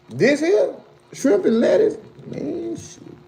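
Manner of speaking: high, excited squeal about the food; excited food reaction. Real, not acted.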